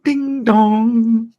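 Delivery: high, sung-out chime; doorbell imitation. Ellen hitting the button from the darkness, chiming like a doorbell. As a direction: ring it out like a doorbell, long last note.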